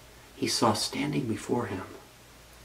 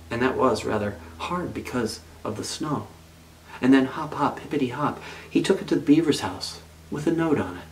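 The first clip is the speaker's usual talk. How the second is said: usual speaking voice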